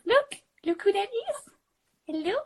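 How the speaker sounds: high cooing voice for a cat. Real, not acted. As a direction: sweet-talk the kitty.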